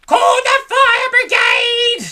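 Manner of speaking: put-on high, loud and slow old-woman shout; panicked shout. A narrator's reading. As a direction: bellow it like the house is burning. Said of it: "she shouted suddenly".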